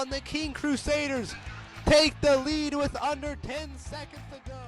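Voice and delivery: ordinary talk, nothing marked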